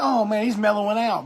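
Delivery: ordinary delivery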